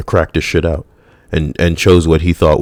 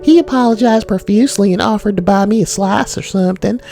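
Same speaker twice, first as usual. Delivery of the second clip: put-on very high girly voice throughout; valley girl commenter. Reading a woman commenter's pizza story, very high girly. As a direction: gush it like an excited girl. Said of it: "Somebody else said".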